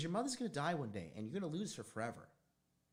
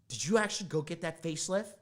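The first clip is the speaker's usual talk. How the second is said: loud accusing question; 6 semitones higher, 7 dB louder than usual. Retold or imitated. Questioning mom on phone.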